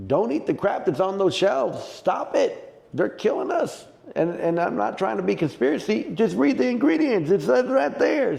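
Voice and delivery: high, fired-up plea, stop it; impassioned rant, pitched high. Real, not acted. Urging the folks watching to stop eating processed food, fired up and high. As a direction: plead with them to quit the junk. Ranting about food on shelves.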